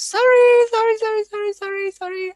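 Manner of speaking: a hammed-up run of apologies, fading away; mock apology. Mock apology after failed import.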